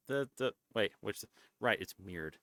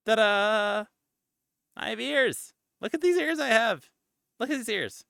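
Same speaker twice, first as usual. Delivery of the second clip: much higher and louder, gleeful show-off; excited reveal; 9 semitones higher, 9 dB louder than usual. Real, not acted. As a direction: present the ears like a big surprise. Showing off new cosplay ears.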